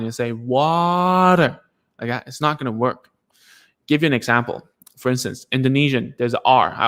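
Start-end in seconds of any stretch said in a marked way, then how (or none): 0.3-1.6 "Water" drawn out very long, higher pitched; slowed-down word. Shown as a demonstration. Parent slowing a word down for the child, slowed-down "Water". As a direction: stretch the first syllable of "Water" way out. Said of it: "if you slow it down".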